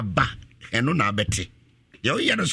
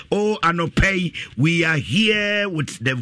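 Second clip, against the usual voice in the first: ordinary talk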